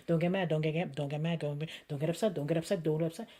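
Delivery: low, hushed, rapid inner chant; muttered self-talk. Acted. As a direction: talk yourself down under your breath. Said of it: "I say to myself in my mind".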